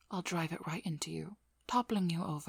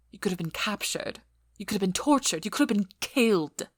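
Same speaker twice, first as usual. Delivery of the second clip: building to a loud outburst; louder with each danger listed, peaking at the end; alarmed scolding; 2 semitones higher, 9 dB louder than usual. Acted.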